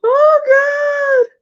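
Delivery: high, loud swooning cry of delight. Real, not acted. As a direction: cry out, overwhelmed by the thought of him. Swooning over handsome actor.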